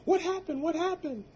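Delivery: very high, loud frightened calling; wife's scream. Retold or imitated. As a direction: shout it down the stairs in alarm. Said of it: "my wife screams down".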